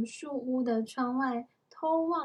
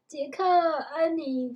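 slow, raised calling of names; mother calling; 4 semitones higher, 6 dB louder, slower than usual. A narrator's reading. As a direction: call the children from afar. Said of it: "他在喊着".

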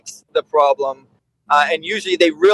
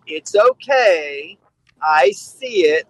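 slow, calm soothing words; reassuring; much slower than usual. Retold or imitated. Handler calming a scared dog during training, slowly and gently. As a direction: settle the dog, unhurried.

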